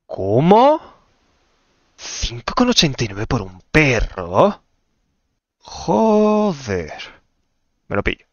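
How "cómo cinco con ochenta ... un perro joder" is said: shocked outburst. Real, not acted. Player balking at the price of a dog, shocked. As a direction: exclaim in loud, drawn-out shock.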